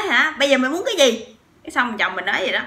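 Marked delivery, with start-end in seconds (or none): 0.0-1.3 higher, louder confrontational retort; mock challenge. Husband confronting the stranger, acted angry challenge. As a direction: throw down the challenge like a tough guy. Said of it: "nổi máu côn đồ lên".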